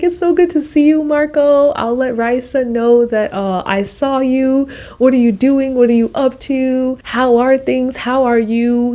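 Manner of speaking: high, bright reunion chatter; excited greeting. Retold or imitated. Greeting her friendly guide on the street, high and excited. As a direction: gush with joy at seeing him.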